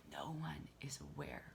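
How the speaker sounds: whispered confession, no voice at all. Real, not acted. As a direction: let it drop to a secret whisper. Confiding aside to audience.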